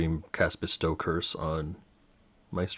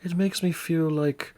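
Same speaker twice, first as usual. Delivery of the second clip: snooty voice; 9 semitones higher, 3 dB louder than usual. Acted. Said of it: "Kettle Stream now is like".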